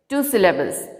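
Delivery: ordinary talk, nothing marked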